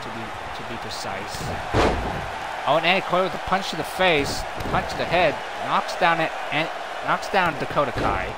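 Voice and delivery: brisk, running wrestling call; play-by-play commentary. Acted. Commentator calling the match for viewers, brisk play-by-play. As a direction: keep the action rolling like a ringside caller.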